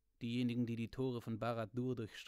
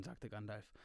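plain reading voice

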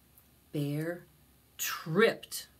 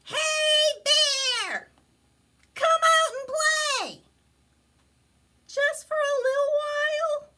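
very high, loud critter calls; squeaky friends shouting up; friends calling; 7 dB louder than usual. Acted. Friends shout for Bear to come play, very high.

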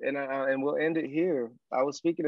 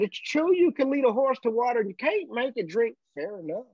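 raised-pitch cowboy twang, easing down at the end; thick Texan accent; 7 semitones higher than usual. Retold or imitated. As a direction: concede it like a mock Texas rancher. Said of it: "and this thick accent".

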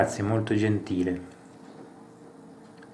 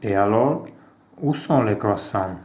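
reading out a café dialogue with light character voices; acted dialogue reading; slower than usual. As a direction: play both sides of the café scene, lightly. Reads French café dialogue aloud. (lettura animata di un dialogo in francese)

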